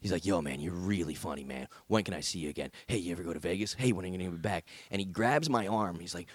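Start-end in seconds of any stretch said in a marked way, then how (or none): 0.2-4.7 a low, soft fan voice; low eager fan. Retold or imitated. Clingy fan pestering the comedian, low soft eager voice.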